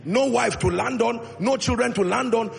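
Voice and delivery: ordinary talk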